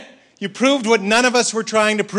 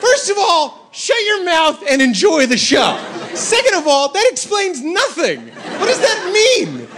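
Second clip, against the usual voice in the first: incredulous rant; 9 semitones higher than usual. Acted. Comic berating the magnet guy, high and exasperated. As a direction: tear into him in disbelief.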